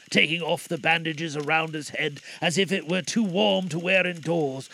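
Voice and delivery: ordinary delivery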